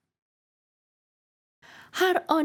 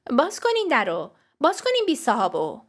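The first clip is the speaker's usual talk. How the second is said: high, forceful demand through a door; loud, exasperated order; shouting; 6 semitones higher, 4 dB louder than usual. Acted. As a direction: yell to be let in.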